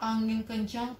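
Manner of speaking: plain reading voice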